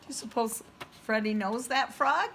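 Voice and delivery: usual speaking voice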